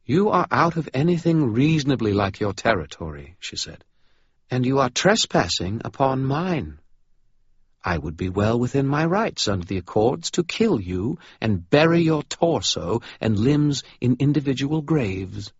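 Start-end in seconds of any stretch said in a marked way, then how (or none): 0.1-3.4 high, cool, formal British vampire; regal vampire. Acted. Vampire baroness greeting Dresden, cool and formal. 4.5-15.5 high, cool, formal British vampire; regal vampire. Acted. Vampire baroness greeting Dresden, cool and formal.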